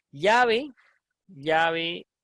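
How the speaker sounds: LLAVE with the Spanish palatal "ly" sound, like "lyá-ve"; traditional ll pronunciation. Shown as a demonstration. Teacher demonstrating how she says it, llave with a palatal "ly". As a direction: say llave with the tongue flat on the palate, "LYA-ve". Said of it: "yo digo llave".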